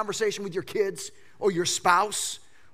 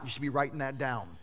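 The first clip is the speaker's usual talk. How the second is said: usual speaking voice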